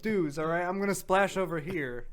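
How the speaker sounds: playing a Texan pool guy; Texas accent. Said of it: "That was my Texas accent".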